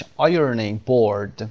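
American "ironing board", both R sounds voiced; slow, careful American English demonstration; American pronunciation. Shown as a demonstration. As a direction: say it American with clear Rs in both words. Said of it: "تلفظ امریکنش".

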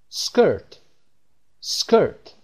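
سکرت said slowly as skirt, pitch rising on repeat; careful English word. Shown as a demonstration. Teacher modelling سکرت for learners, careful pronunciation. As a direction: pronounce سکرت carefully for students. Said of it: "vocabulary word read for learners".

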